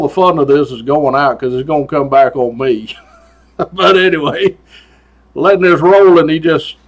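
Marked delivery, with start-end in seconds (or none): none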